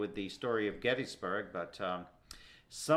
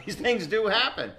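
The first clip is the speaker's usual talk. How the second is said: says it through a laugh, pitched up high; chuckling while insisting it happens; 9 semitones higher, 4 dB louder than usual. Real, not acted. Host laughing as he assures a sceptical co-host. Host teasing doubtful guest.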